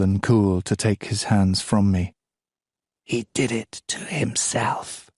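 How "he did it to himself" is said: slow, unvoiced whisper; a slow, cold whisper. A narrator's reading. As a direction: breathe it out coldly. Goddess mother over corpse.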